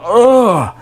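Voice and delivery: high retold groan of pain; mock agonized "ugh". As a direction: act out peeling a torn lip free. Retelling lip stuck to pillow.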